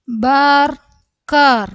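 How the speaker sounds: slow, high, loud, broken in two; syllable by syllable. Shown as a demonstration. Teacher breaking a word up for learners, syllable by syllable. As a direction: call out each syllable separately.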